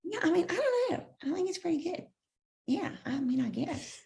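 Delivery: breathy Georgia twang; Holly Hunter voice. Shown as a demonstration. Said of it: "I do a really good, um, Holly Hunter too".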